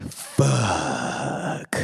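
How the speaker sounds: long, drawn-out mock groan of dread; dismayed back-seat curse. Brian in the back as rum rolls out before police, drawn-out dismayed curse. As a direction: stretch the curse out slowly, as if sinking.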